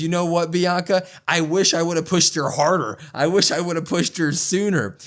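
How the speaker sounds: hammed-up wrestling-promo fury; mock angry rant. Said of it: "And she goes".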